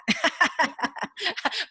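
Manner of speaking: high, delighted laugh; happy laugh. Real, not acted. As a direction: laugh it off brightly. Laughing at lost nights.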